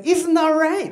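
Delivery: high-pitched accented dad imitation; high, emphatic mock-dad punchline; mimicking dad. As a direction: hand down the moral like dad. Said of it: "Be like".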